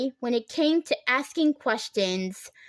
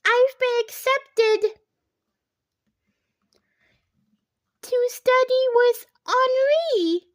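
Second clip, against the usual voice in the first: high, louder, slow giddy announcement; can barely contain the joy; giddy near tears; 12 semitones higher, 5 dB louder than usual. A narrator's reading. Stylist announcing her acceptance, giddy and high. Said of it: "sort of laughing and crying".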